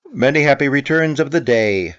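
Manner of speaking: loud high call across the river. A narrator's reading. Pooh calling to Eeyore across the river, loud. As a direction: shout the greeting from far away. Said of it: "called out Pooh".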